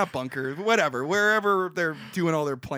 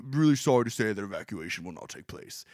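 Churchill; 8 semitones lower, 4 dB quieter than usual. Acted. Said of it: "obviously I'm doing the voice for Churchill".

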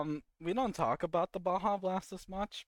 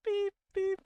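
car alarm beeping. Retold or imitated. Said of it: "It's just a car alarm".

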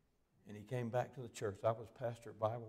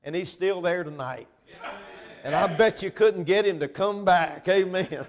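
much higher, far louder preaching shout; preaching climax; 9 semitones higher, 19 dB louder than usual. Acted. Preacher rousing the congregation, shouting with joy. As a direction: build it to a full-throated preacher's cry. Sermon climax about heaven.

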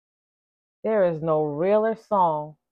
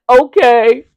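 high, loud scandalized okay; clutching pearls; shocked, pearl-clutching okay; mock alarm; 6 semitones higher, 13 dB louder than usual. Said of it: "'cause I'm like".

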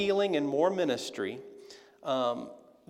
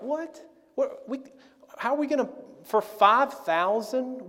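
incredulous disbelief; 8 semitones higher than usual. Retold or imitated. Disciples balk at feeding five thousand, high incredulous. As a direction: squeak out shocked disbelief. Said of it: "they're like".

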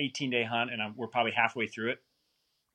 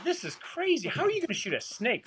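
high-pitched, disbelieving protest; incredulous; 14 semitones higher than usual. Retold or imitated. Hunter inwardly doubting guide's snake plan, incredulous.

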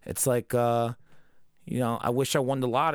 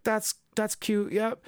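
higher, slow, indulgent mock-mom voice; mom humoring him; 9 semitones higher, much slower than usual. Mom humoring little Deku's hero dream, slow and indulgent. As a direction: humor the kid, half-hearted. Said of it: "And she's like".

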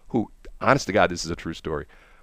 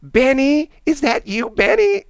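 much higher, louder, sing-song call of Benny; squeaky drunk lady; 5 dB louder, slower than usual. Retold or imitated. Drunk secretary calling up to the officer, high sing-song. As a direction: pitch way up like a tipsy old secretary. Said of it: "And she goes".

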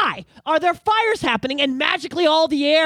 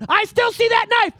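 higher, louder and slow, pressing the point; insistent mock Pierre; 11 semitones higher, 5 dB louder than usual. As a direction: keep pointing at the knife.

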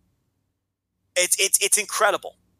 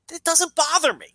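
ordinary delivery

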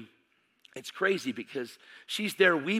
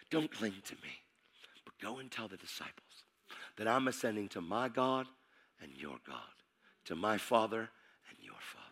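much softer, hushed and tender; soft Jesus; 4 semitones lower, 10 dB quieter than usual. Acted. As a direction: tell her quietly, tenderly. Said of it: "He says".

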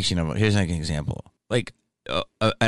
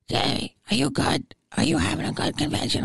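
fan lady voice; 9 semitones higher than usual. Retold or imitated.

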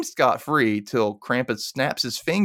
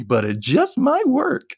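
high and slow, hammed up; milk the cliche; quoting the film's hero line in a high, slow mock-heroic voice; dramatic hero line; slower than usual. Plotting line quoted from film.

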